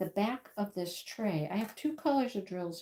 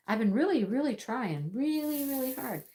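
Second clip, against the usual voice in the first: each really pushed higher; frustrated crafter stressing really, higher; stressed really; 5 semitones higher than usual. Real, not acted. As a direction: plead it, stretching every really. Pleading about unfinished project.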